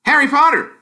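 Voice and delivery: high, loud reveal of the name; a kid springing the big news; triumphant boy. Acted.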